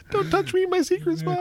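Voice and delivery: very high squeaky joke voice. Acted. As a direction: squeak it like a cartoon. Joking creepy high voice.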